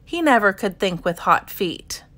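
usual speaking voice

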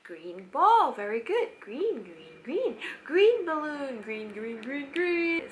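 lively sing-song repeats of green; excited sing-song. Shown as a demonstration. Parent commenting on the app, sing-song. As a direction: cheer each green like a game. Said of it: "Keep talking".

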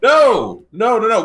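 loud, high denial; loud protest. Real, not acted. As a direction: insist loudly that you did not. Protesting accusation.

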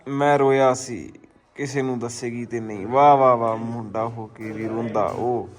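slow, drawn-out recital of a sad poem; poem read aloud slowly with feeling; dramatic poem reading. Acted. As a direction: read each line slowly, like a heartfelt verse.

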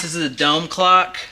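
usual speaking voice

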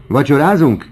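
high, loud exclaimed question; kiáltás. A narrator's reading. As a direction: cry it out to the whole company. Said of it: "kiáltotta Horace Bianchon". (hangos, magas felkiáltás)

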